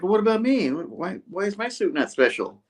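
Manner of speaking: mock-whine about his suit; jealous Harry. Jealous Harry complains to the others in a high whine. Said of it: "Harry's like".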